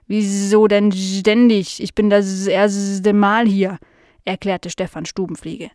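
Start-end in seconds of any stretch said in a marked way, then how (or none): 0.1-3.8 slow housefly with drawn-out buzzing s sounds; curious housefly. Acted. Fly meeting Nala for the first time, slow and buzzy. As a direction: stretch the words like a dopey, curious fly.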